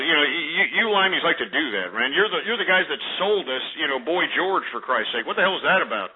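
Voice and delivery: normal talking voice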